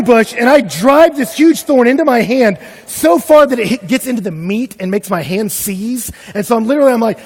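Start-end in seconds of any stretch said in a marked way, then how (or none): none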